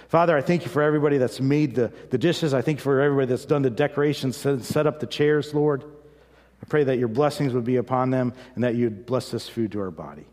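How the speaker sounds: quick, thankful mealtime blessing; saying grace. Acted. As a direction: thank God for the cooks and the food. Blesses the food before meal.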